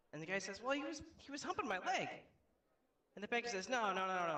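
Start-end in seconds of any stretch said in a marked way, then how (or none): 0.6-2.1 high-pitched, pleading self-defence; exasperated excuse. Acted. Dog owner explains himself to banker, high and defensive. 3.7-4.4 louder, higher run of denials; emphatic objection. Acted. Banker corrects the dog owner, loudly insistent.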